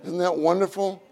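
ordinary talk, nothing marked

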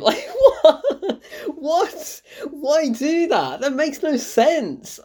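very high, baffled, half-laughing; incredulous laughter. Real, not acted. Critic baffled by a senseless plot twist, high incredulous laughter. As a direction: squeak in disbelief, then drag out the complaint.

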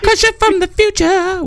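high, far-off mimicry of a friend; squeaky mock echo; mocking imitation. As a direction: parrot his line back in a silly high voice. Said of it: "All I heard was".

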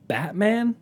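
high, rising Batman in disbelief; acted-out confusion, pitch climbing; baffled question. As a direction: repeat it as a bewildered question. Still baffled by misheard word.

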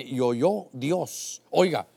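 reading the verse slowly and low; slow scripture reading. Acted. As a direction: read it like holy scripture, drawn out. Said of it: "dice en el verso diecisiete".

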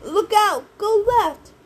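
high, loud screech of warning. A narrator's reading. Gray lady to the driver, screeched warning. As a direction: screech it out in sudden alarm. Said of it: "sitting in the middle screeched".

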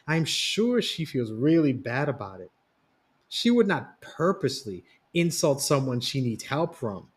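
usual speaking voice

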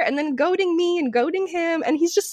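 normal talking voice

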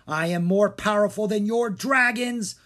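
hammed-up thundering wizard. A narrator's reading. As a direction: boast in a rage. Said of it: "Maldred thundered".

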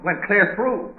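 startled at the arrow through the throat; shocked discovery. Acted. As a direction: blurt it out, horrified. Discovering arrow-pierced corpse.

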